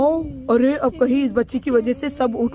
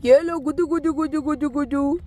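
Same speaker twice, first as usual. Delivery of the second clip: put-on tickling baby talk; 3 semitones higher than usual.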